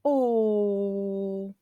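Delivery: "Oh" held very long; long sustained "Oh"; children's long Oh. Acted. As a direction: draw out "Oh" on a slowly settling tone. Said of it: "and the children said".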